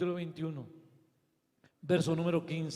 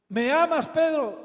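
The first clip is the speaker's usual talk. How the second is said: loud, raised-pitch challenge; insistent question; 7 semitones higher, 8 dB louder than usual. Acted. The Lord asking Pedro if he loves him, loud and insistent. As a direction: put the question forcefully, pitched high. Acts Jesus questioning Peter.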